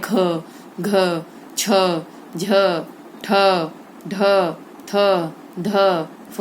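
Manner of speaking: ख, घ, छ each with a forceful puff of air; strongly aspirated. Shown as a demonstration. Teacher lists mahapran letters, ख to फ drawn out with breath. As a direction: breathe out hard on ख, घ, छ, one by one. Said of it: "अधिक मात्रा और वेग से".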